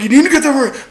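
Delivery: high nagging boss mimicry; mocking the boss. Retelling the boss repeating himself, high mocking whine. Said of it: "He t-, started".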